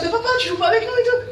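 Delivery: put-on very high little-kid voice; whining son. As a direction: whine at Dad like a small child. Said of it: "Il était là comme ça". (voix aiguë de petit garçon)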